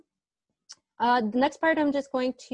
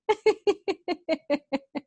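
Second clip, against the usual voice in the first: high giggle; 6 semitones higher than usual. Real, not acted. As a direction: keep giggling. Laughing at autocorrect answer.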